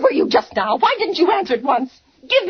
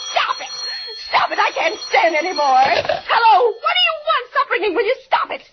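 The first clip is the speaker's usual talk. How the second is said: much higher, frantic cries; hysterical screaming; 5 semitones higher than usual. Acted. Terrified woman shrieking at the ringing phone.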